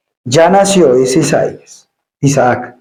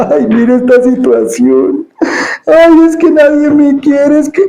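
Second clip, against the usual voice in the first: high, louder complaining wail, mocking a self-pitying believer; whiny self-pity; 10 semitones higher, 3 dB louder than usual. Preacher mocks a self-pitying believer, whiny high lament. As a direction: sigh it out like someone sure nobody loves them. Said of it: "en vez de estar".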